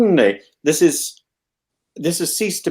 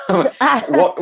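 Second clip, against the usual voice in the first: laughing; 6 semitones higher than usual. Real, not acted. Laughs mid-phrase.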